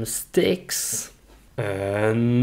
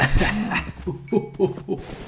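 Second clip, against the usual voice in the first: breathy giggle; 6 semitones higher, 5 dB quieter than usual. Real, not acted. Laughing at hitting cows.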